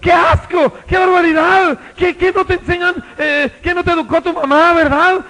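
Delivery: high, loud, indignant complaint; outraged shout. Retold or imitated. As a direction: shout at the rude stranger. (el ciego indignado grita a Jesús)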